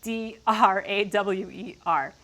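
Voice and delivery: slow, letter by letter; deliberate slow spelling; spelled out. Retold or imitated. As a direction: spell it out one careful letter at a time. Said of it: "the word".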